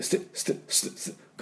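スティ as a quick unvoiced "st"; whispered, clipped "st" for スティ; vowelless ST. Shown as a demonstration. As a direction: hiss スティ fast with no U after the S. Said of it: "ここまで早く言わないと".

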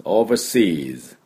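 overseas drawn out, ending on a buzzed S; final S sounded. Shown as a demonstration. Teacher noting the word takes an S, slow model pronunciation. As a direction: say overseas slowly, keeping the final S. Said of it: "Sがつきます".

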